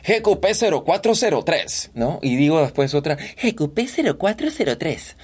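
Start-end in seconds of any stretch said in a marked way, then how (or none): 0.1-1.9 pitched up, changed timbre; voice-actor warm-up; higher put-on voice. Shown as a demonstration. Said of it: "Hago cualquier voz". 3.2-5.2 third voice on the plate; voice-actor warm-up; yet another put-on voice. Shown as a demonstration. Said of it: "Hago cualquier voz".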